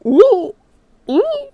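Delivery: high, loud gleeful shrieks; excited squeal. Retold or imitated. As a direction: relive the moment of realizing. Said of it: "I was like".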